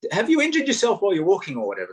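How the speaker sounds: put-on high, quick teenage question; teenager asking. A teenager at the back asks the walker a question, high and quick. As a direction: rattle off the question like a teen at the back. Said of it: "she'd go".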